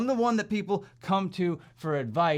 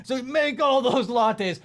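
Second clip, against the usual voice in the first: higher, louder and slow; mock boss order; 7 semitones higher, 5 dB louder, slower than usual. Head office mocked ordering lattes, slow, high and loud. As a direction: drag it out like a smug boss. Mocking corporate demand.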